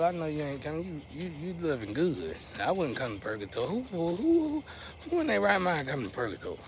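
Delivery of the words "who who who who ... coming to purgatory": playful high-pitched disbelief; incredulous. Real, not acted. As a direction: stretch the who up high, then rush the question. Mock-incredulous rhetorical question, drawn-out who.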